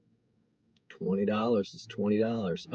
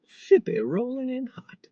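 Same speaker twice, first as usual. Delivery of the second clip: singsong callout; slower than usual. Pretend. Driver calls out order.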